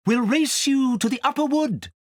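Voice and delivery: high, light owl challenge. A narrator's reading. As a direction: tease like a racing owl. Owls challenge Kay.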